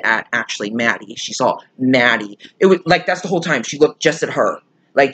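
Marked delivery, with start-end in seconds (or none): none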